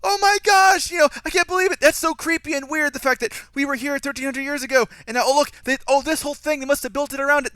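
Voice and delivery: hammed-up breathless excitement; mock overexcited gushing. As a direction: parody characters who spell everything out. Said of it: "the characters would have been going".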